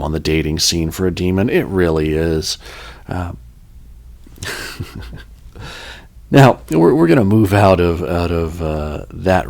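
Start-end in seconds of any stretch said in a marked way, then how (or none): 4.4-5.2 low-key breath of a laugh; quiet chuckle. Real, not acted. Host amused by own demon dating joke, quiet chuckle. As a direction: chuckle softly, almost without voice.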